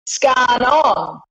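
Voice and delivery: "Scan on" clipped bogan Aussie slang; bogan Aussie accent. Shown as a demonstration. Explaining bogan slang to an American, bogan Aussie accent. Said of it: "it would simply be".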